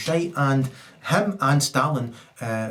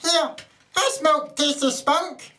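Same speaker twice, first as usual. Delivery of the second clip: high, slow, squeaky daughter; daughter's voice; 14 semitones higher, slower than usual. Retold or imitated. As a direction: play the daughter complaining. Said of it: "his daughter says".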